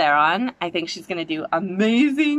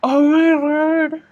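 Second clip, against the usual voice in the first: higher, louder, slow exclamation; overwhelmed; 7 semitones higher, 5 dB louder, slower than usual. Real, not acted. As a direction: draw it out, overwhelmed. Reacting live to trailer.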